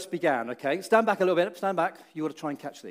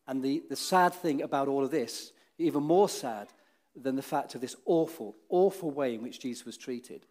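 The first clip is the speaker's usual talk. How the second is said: ordinary talk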